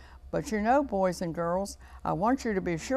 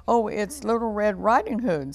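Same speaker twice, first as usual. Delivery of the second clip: lightly put-on higher little voice; a wolf faintly mimicking a little girl; wee little voice; 4 semitones higher, 6 dB louder than usual. A narrator's reading. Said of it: "in a wee little voice".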